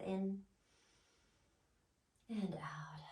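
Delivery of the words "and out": soft breathy whisper on the out-breath; whispered exhale. Real, not acted. Breath cue in yoga class.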